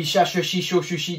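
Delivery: cha, che, chi rattled off without gaps; rapid one-breath drill. Shown as a demonstration. Teacher attempting the challenge, rapid-fire syllable chant. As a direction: race evenly through the syllables on one breath. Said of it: "d'une seule respiration".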